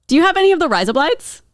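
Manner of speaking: high, fast Australian accent; Aussie accent. Shown as a demonstration. Host asks for razor blades in an Aussie accent. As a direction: ask it like a quick Aussie. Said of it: "razor blades in an Aussie accent".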